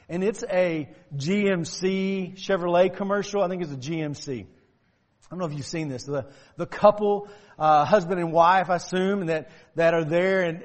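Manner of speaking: ordinary talk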